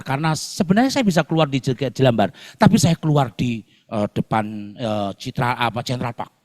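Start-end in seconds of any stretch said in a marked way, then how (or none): none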